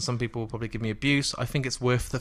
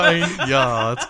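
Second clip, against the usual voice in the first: laughing; 7 dB louder than usual. Real, not acted. Laughing at own slip.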